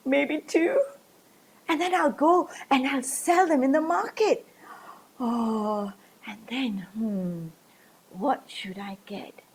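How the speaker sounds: plain reading voice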